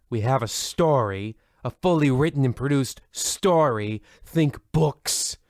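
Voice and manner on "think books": usual speaking voice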